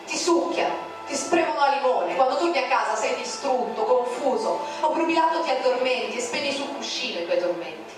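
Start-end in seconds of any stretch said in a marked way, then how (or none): none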